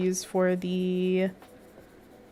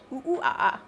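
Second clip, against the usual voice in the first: monkey noises; 4 semitones higher than usual. Pretend. Monkey sound during stretch.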